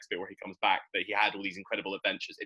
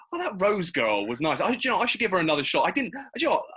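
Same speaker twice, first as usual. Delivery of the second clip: much higher and louder, a hammed-up sudden realisation; scatterbrained Doctor impression; 11 semitones higher, 6 dB louder, faster than usual. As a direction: play the Doctor as a giddy, forgetful goof. Mocking the Doctor's sudden return.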